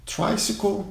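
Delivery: tricycle said TRY-si-col, English way. Shown as a demonstration. Aviation teacher models tricycle for Brazilian students, in English. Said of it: "Cycle é cycle".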